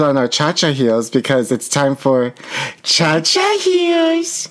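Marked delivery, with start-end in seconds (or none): 2.8-4.5 very high, long drawn-out squeal; high-pitched, drawn-out catchphrase; show-title call. Real, not acted. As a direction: sing out the title high and stretched.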